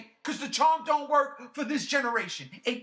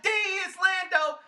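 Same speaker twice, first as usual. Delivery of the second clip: much higher, glad cheer for a favourite; delighted greeting; 9 semitones higher than usual. Retold or imitated. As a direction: hail an old friend on screen. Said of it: "I said".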